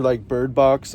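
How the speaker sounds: usual speaking voice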